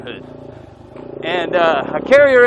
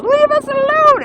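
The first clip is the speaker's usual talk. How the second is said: Candy yelling; 5 dB louder, slower than usual. Retold or imitated. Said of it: "she goes".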